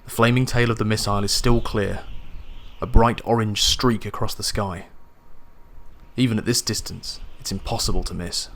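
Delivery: ordinary delivery, nothing marked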